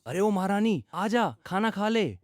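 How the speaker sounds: high, drawn-out slow call across the house; mock-grand call to the daughter to come eat. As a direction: call her in from afar, slowly. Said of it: "चिल्लाकर आवाज लगाई".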